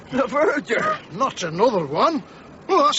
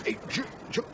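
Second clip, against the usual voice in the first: hushed alarm; 4 semitones lower, 12 dB quieter than usual. Acted. Something moved in the sack.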